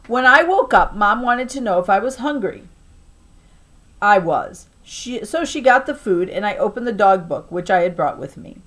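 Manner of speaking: plain reading voice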